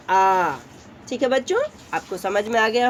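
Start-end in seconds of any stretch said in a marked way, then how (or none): 0.1-0.6 आ held twice as long; आ को दो गुना खींचो; long vowel. Shown as a demonstration. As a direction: stretch the आ to double length. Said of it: "दीर्घ स्वर उससे double बोलेंगे".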